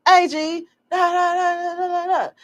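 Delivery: high-pitched nonsense slang patter; imitated slang greeting. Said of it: "don't speak A A V E and start going".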